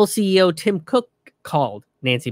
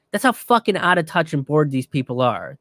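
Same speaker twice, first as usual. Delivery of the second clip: ordinary talk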